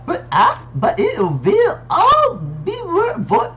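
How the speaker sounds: put-on high, loud German accent. Game character worried about the work, in a German accent. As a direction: lay on a thick German accent. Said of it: "I think it's German".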